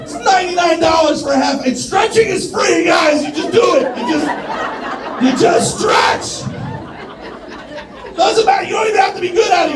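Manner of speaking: shouting rant. Acted. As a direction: yell it like an outraged pitchman. Stand-up bit ranting about stretching.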